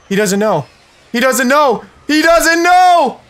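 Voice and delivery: excitement climbing to a drawn-out high shout; mounting excitement. Real, not acted. Gleeful build before surprise attack.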